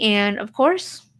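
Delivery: usual speaking voice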